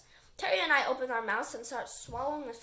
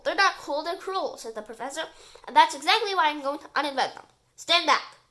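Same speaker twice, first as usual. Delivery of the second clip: stern professor; 3 semitones higher, 8 dB louder than usual. Acted. Professor determined.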